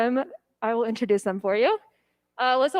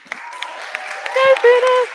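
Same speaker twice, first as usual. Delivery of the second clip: high squealed cheer, then loud; excited cheer; 12 semitones higher, 4 dB louder, much slower than usual. Real, not acted. Cheering new member Hannah.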